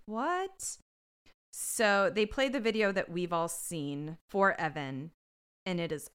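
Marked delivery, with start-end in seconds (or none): 0.1-0.8 high, soft confused reaction; small, uncertain high voice; puzzled unease. Retold or imitated. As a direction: act out a bewildered kid, pitched up. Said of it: "kinda looking around like".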